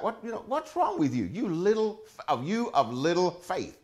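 a hint of a laughing, scolding Jesus. Preacher voicing Jesus rebuking the fearful disciples, lightly scolding. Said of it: "Jesus said to them".

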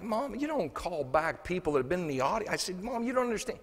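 whiny protest. Retold or imitated. Son protests to his mother.

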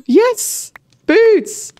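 high, bright burst of joy; excited cheer. Real, not acted. Gamer spotting boots in a chest, excited cheer. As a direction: cheer it out at the loot.

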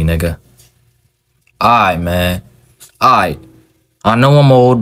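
ordinary delivery

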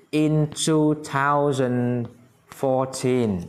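very slow, spelled-out English for the class; slow, clear classroom modelling; slow model reading. Shown as a demonstration. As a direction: stretch each word out for learners. Teacher models English year.

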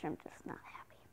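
hushed whispered aside. Real, not acted. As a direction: whisper it under your breath. Whispered aside after sarcastic yay.

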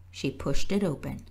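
plain reading voice

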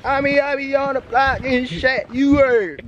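high, drawn-out mock Baltimore street talk; dragged Baltimore accent. Friend mimicking how Baltimore locals talk, dragged Baltimore accent.

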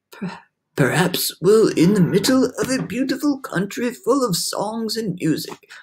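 hopeful storybook elder; old grasshopper. A narrator's reading. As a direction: offer hope, stretching the last words. Old grasshopper imagines something lovely.

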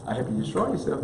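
ordinary talk, nothing marked

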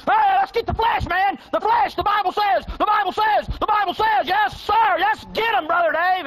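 hammed-up high shout, itching to fight; mock eager brawler. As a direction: whoop it up like a fool ready to fight.

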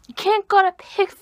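high, loud Draco cosplay voice; Star Kid Draco. Acted. Cosplayer quoting Star Kid Draco to viewers, high and loud. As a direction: put on a high-pitched, protesting character voice. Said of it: "Star Kid Draco, by the way".

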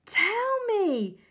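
loud eager whisper; urgent whisper. A narrator's reading. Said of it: "cried Missus Silver".

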